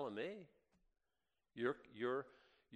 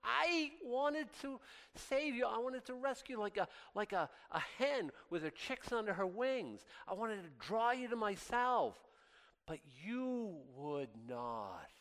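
mild weeping Jesus; 10 semitones higher than usual. Retold or imitated. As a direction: plead like a grieving saviour, lightly acted. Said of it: "Jesus in Jerusalem crying, weeping".

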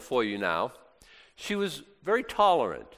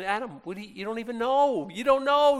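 mock protest; 9 semitones higher than usual. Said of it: "and you're saying".

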